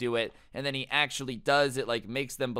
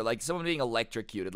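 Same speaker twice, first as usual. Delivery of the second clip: ordinary delivery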